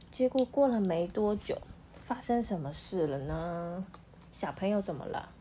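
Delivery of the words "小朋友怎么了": usual speaking voice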